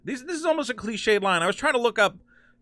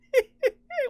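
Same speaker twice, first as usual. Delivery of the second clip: high, squeaky stifled laugh; real giggling fit; helpless giggle. Real, not acted. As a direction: try not to laugh and fail. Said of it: "why am I laughing?".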